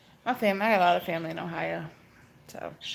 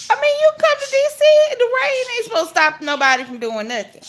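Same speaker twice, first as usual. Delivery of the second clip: much higher, louder, fast teasing; excited rant; 14 semitones higher, 8 dB louder than usual. Real, not acted. Teasing viewers who fear the rain, high fast excited rant. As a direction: fire it off high and quick.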